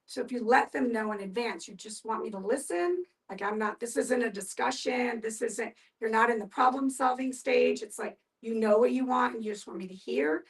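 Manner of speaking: usual speaking voice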